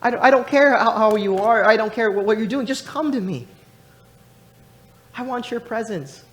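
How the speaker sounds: high, loud impassioned preacher voice, falling off at the end; full-force preaching. Acted. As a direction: plead it from the pulpit, then soften. Preaching climax, voicing God's call.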